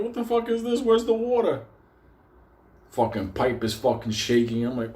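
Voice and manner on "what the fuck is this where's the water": shrill, much higher squeal of outrage; outraged disbelief. Retold or imitated. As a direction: shriek at the dry tap in disbelief. Faucet gives no water.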